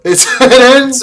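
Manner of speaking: a mocking laugh of relief. Real, not acted. Laughing that the movie ends.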